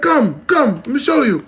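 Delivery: high, loud Chinese Jamaican summons; Chinese Jamaican accent. Retold or imitated. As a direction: order him along in Chinese Jamaican accent. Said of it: "it's literally Chinese Jamaican".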